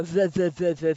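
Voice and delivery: "the" with the tongue out between the teeth. Shown as a demonstration. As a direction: repeat "the", tongue sticking out for the th. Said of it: "It feels stupid".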